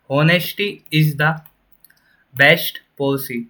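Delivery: very slow, word by word; slow dictation. Shown as a demonstration. Teacher reading the sentence slowly to the class. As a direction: spell it out slowly for students. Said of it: "देखिए".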